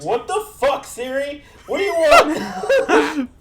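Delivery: high outburst building to a loud yell; exasperated yelling. Real, not acted. Podcaster to Siri butting in, exasperated shout.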